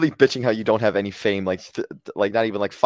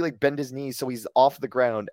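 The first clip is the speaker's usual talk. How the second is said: normal talking voice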